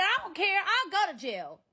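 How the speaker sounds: high, loud acted outburst; defiant shout. Woman re-enacting her answer to his jail threat, defiant shouting. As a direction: yell it back in defiance.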